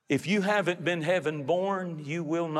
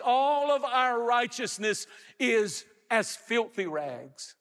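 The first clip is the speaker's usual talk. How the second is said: raised, insistent pulpit voice; emphatic preaching; 7 semitones higher than usual. Real, not acted. Preacher warning listeners no good work will get them there, emphatic preaching. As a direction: preach it hard and high.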